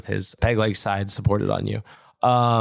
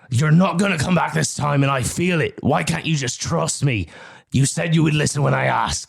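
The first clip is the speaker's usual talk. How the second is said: raised, desperate plea; desperate yell; pleading shout; 7 semitones higher than usual. Acted. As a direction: shout it, begging her to trust him. Shouted quarrel across the dock.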